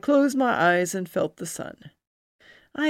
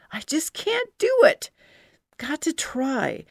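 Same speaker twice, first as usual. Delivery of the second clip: strained fear; 9 semitones higher than usual. Acted. Struggling on galloping horse.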